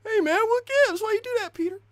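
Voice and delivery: very high, squeaky mock complaint; put-on bystander voice. Bystander complaining to Peter, squeaky put-on voice. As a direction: whine it way up high, hammed up.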